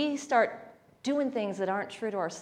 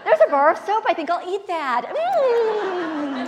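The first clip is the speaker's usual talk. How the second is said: much higher, louder, gleeful dog voice; delighted dog; 9 semitones higher, 7 dB louder, slower than usual. Retold or imitated. Owner voicing her dog, gleeful in the bathroom. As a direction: coo like a dog loving its punishment. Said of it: "It's like".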